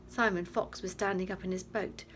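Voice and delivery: plain reading voice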